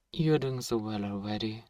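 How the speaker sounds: ordinary talk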